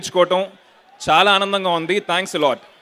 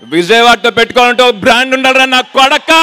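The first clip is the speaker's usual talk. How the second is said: loud, higher, menacing villain dialogue; menacing movie punchline; 4 semitones higher, 6 dB louder than usual. Acted. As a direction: deliver the villain's punch line with threat. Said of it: "డైలాగా?".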